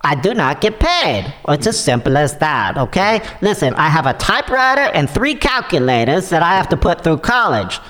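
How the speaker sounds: lightly put-on Italian accent, pleading; shady caller with an Italian accent. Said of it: "sounds like an Italian living in Nigeria".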